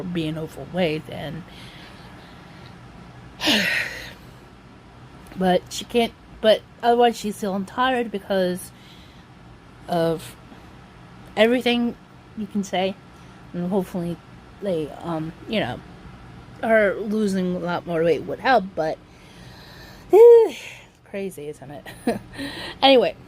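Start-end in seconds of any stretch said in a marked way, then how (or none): 3.4-4.1 short breathy sigh. Real, not acted. Daughter talking about her tired mother, real sigh. Pauses over mother's health troubles. 20.1-20.7 high, loud exhaled sigh. Real, not acted. Daughter reflecting on her mother's weight troubles, loud sigh. Sighs over mother's situation.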